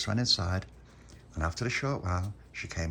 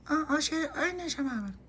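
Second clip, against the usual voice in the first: put-on very high, babyish mumble. Baby Lucia answering Pop, very high toddler voice. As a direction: lisp it like a tiny toddler.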